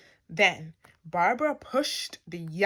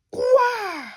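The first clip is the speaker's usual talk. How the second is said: high, loud wailing groan; big cartoon groan; 14 semitones higher, 7 dB louder than usual. A narrator's reading. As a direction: groan like a tortured bed. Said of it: "and groaned".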